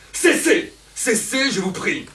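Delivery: high loud cry of cessez; furious outcry. Acted. Elvire begging Don Juan to stop, with a high loud cry. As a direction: scream at him to stop.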